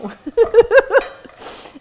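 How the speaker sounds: a burst of high, loud real laughter. Real, not acted. Chef laughing at her own Southern accent bit, a loud real laugh.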